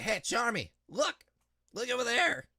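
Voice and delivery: high, loud anime hero voice; bright excited anime protagonist; anime boy voice. Pretend. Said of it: "I am so anime protagonist voice only coded".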